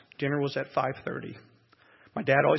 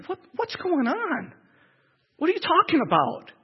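put-on high, bewildered cry of a confused boy, louder at the end; a kid who can't grasp the news, pleading; shocked child; 13 semitones higher, 4 dB louder than usual. Retells his shock as a child.

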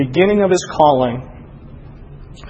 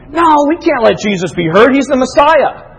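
raised, louder pitch, objecting in mock protest; acted protest; 8 semitones higher, 4 dB louder than usual. Preacher voicing human reasoning against God's plan, in acted protest.

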